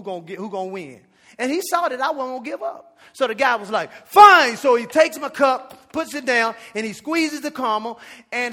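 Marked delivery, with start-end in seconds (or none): 4.1-4.6 exasperated fine. Retold or imitated. Barista gives in angrily.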